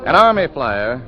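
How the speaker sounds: usual speaking voice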